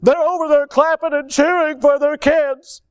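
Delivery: high, loud urgent tattling; alarmed report. Retold or imitated. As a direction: rush in to report the noisy parents, breathless. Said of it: "and said".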